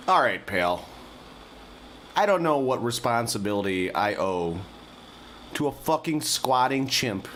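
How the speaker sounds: angry rant. Acted. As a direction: vent at full tilt about the chimp. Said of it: "while I was yelling, more or less".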